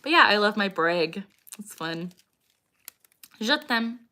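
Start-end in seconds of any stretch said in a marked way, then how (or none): none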